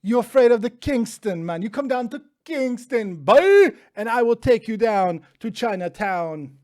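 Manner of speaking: loud, high hammed-up Jamaican accent; a lightly put-on Jamaican Rasta voice. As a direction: play the Rasta character, loud and brash. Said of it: "than Rasta Dev".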